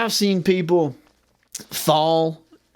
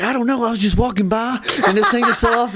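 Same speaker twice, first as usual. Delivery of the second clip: higher-pitched imitation of an excuse; mock innocent denial; 6 semitones higher than usual. Customer who broke something explains to clerk, mock innocent. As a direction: lightly play the innocent customer. Voices customer denying a breakage.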